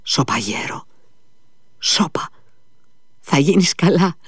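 sudden half-angry outburst; cried out, as if angry. A narrator's reading. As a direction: snap at him to hush, almost angry. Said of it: "φώναξε ξαφνικά ο Κόλια, σαν να θύμωσε".

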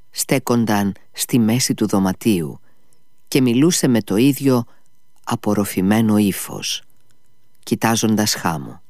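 ordinary delivery

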